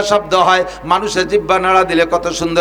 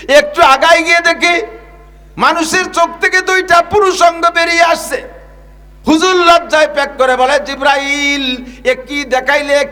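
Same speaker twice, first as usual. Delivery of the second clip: full-force preaching; 9 semitones higher than usual. Real, not acted. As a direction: preach it out at the top of the voice.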